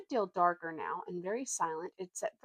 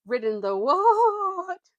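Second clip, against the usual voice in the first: the words come out as a horse's neigh; 12 semitones higher, 11 dB louder, much slower than usual. Acted. Horse asks Shasta in disbelief, neighing. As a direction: stretch the words out high into a whinny. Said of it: "it came out as a sort of neigh".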